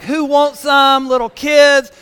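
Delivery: loud slow playground taunt; mock taunt. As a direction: challenge all the little kids, hammed-up. Taunting after tug of war.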